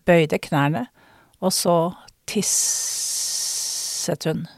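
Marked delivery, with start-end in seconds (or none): 2.3-4.2 "tisset" with a very long hissing s; drawn-out hiss. A narrator's reading. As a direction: stretch the s in "tisset" like a running stream. Storyteller mimics the stream in the word.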